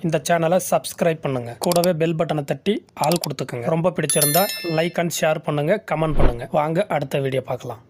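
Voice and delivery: low, steady subscribe-and-like pitch; promo outro read. Acted. Host asking viewers to subscribe, in a low announcer read. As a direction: read the channel sign-off like an announcer.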